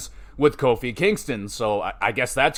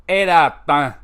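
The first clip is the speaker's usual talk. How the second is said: louder, punchy verdict announcement; rating call; 6 dB louder than usual. Acted. Recapper announcing his match score, punchy louder call. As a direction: deliver the score like a show host.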